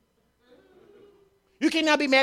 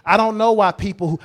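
ordinary talk

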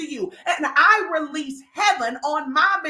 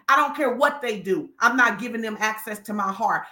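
normal talking voice

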